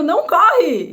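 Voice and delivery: loud, high "Não corre!"; loud high call. Retold or imitated. Teacher calling to a running pupil: loud high call. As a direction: call out sharply. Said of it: "e eu".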